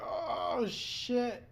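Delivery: high, startled retold cry. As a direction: squeak out the shock of the hit. Retold shock at crash impact.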